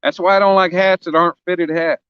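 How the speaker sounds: normal talking voice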